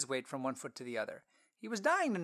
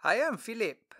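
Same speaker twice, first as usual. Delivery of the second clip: higher, louder friendly traveler; Philip voice; 8 semitones higher, 7 dB louder than usual. Acted. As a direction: introduce yourself warmly.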